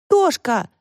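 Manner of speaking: high, excited call for a friend. A narrator's reading. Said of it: "закричала Тася".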